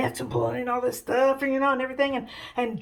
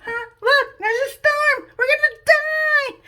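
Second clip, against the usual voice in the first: hammed-up panic, high and loud; drawn-out terrified mock shriek; freaking out; 7 dB louder, slower than usual. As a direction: wail it slowly at the top of your voice. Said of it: "everybody freaks out".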